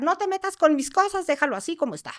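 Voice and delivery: a retold, high-pitched scolding; retold yelling grandma. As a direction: snap at her in a high grandma voice. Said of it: "mi abuela le empieza a gritar".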